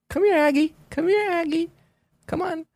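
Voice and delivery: very high trainer falsetto; imitated high-pitched coaxing; coaxing animal call. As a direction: call a pet over in a tiny high voice. Trainer calling the bear over.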